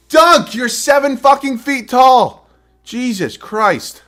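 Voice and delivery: high, loud, slow enraged rant; furious yell. Real, not acted. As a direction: rage at the screen, stretching each word. Rage at player not dunking.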